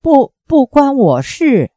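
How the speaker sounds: narrator's acted cry of alarm. A narrator's reading. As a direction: blurt it out in mild panic, stumbling on the first word. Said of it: "惊呼".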